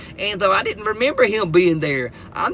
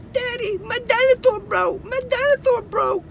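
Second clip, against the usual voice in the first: put-on high little girl voice, much higher. Little daughter telling daddy her dinosaur broke, high child voice. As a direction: play a small toddler upset about her toy. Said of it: "she comes in there".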